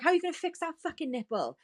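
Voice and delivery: high-pitched, incredulous fury; retold frantic outrage. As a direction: demand an answer, voice shooting up. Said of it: "like, going insane now".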